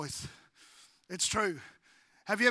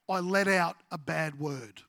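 ordinary talk

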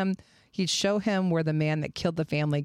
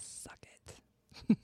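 teasing under-breath whisper; whispered taunt; 11 dB quieter than usual. Real, not acted. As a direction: whisper it smugly.

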